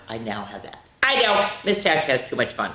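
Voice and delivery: usual speaking voice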